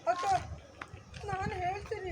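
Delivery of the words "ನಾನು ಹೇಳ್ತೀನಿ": tearful voice. Shown as a demonstration. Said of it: "ಅಳೋ ಥರ voice".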